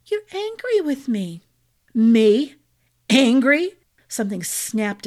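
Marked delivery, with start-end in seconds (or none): 0.1-1.4 higher, hurt and pleading; wounded, stating it gently; hurt wife voice. A narrator's reading. Bianca hurt, confronting husband. 1.9-3.8 louder, incredulous scoff; mock disbelief. A narrator's reading. Husband bristling at accusation.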